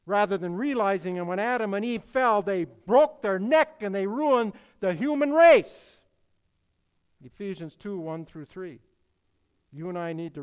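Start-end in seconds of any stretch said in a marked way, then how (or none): 0.1-6.0 much louder and higher, slowing on the last words; preaching at full force. Real, not acted. Preacher hammers home how badly humanity fell, at full force. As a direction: thunder it out to the pews. Preacher builds to climax on fall.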